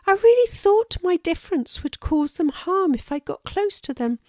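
a little squirrel standing up for herself; earnest little squirrel. Acted. Lulu tells the hedgehog she is just different, high and earnest.

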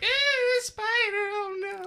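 very high, squeaky mock fright; mock spider fright. Host teases guest about fearing spiders, mock squeal. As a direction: ham up a scared shriek. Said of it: "one of those people that are like".

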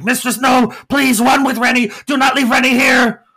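very high, loud gargoyle shout; frantic gargoyle. Acted. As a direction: yell at the mistress to run. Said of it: "And Rex is like".

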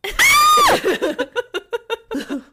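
high creepy doll cackle; evil cackle. Acted. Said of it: "[name] lets out a cackle".

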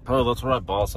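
ordinary talk

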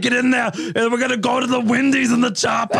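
Arnold impression. Shown as a demonstration. Cohost showing off his Arnold, shouting and hammy. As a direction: yell it like a movie action hero. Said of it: "Let me see it".

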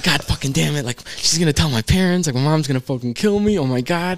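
high-pitched retold panic; freaking out. Teen fearing his mom will find out, mock panic. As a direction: act out a teen dreading his parents. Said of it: "we start freaking out again".

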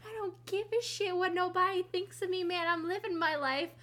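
high-pitched mock defiance; acted brazen declaration; defiant imitation. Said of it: "Kitty's just like".

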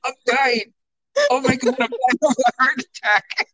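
high, fast mock panic over a heart attack; mock dramatic mom. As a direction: ham up a mom's fake dying. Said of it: "and you're like".

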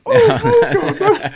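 very high, loud wailing Scottish plea; Scottish producer's anguish. Retold or imitated. Imitating his producer at the Trump interview, high wailing plea. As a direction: wail it like a horrified Scottish woman. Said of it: "the voiceover goes".

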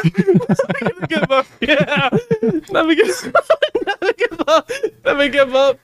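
high-pitched, giggling chant; laughing refrain. Real, not acted. Podcaster cracking up at his own chant, laughing high. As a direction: try to keep chanting through laughter.

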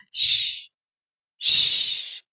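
soft unvoiced "shh"; long theatrical shushing; drawn-out shush. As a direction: hush someone slowly, twice. Acted shushing in a recap.

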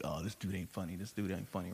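low, hushed heckler's aside; imitated whispering. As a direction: quietly imitate a heckler confiding in his friends. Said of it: "he's, like, whispering to all his boys".

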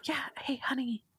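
a hint of a gossipy spouse whisper; mock whisper to spouse. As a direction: imitate the chatty neighbor leaning over to whisper. Mocking chatty theater neighbor.

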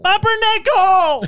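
high, drawn-out delighted cry; delighted shout. Real, not acted. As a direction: cry out the word in glee. Delighted by safe word.

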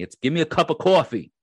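proud Long Island accent on coffee, stretched aw. Shown as a demonstration. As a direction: lean into the Long Island cawfee. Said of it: "I am from Long Island".

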